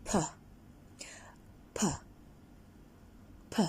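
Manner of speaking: P said as a puff of air; puffed P sound. Shown as a demonstration. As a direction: blow out "P" like a candle. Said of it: "you puff it and you say".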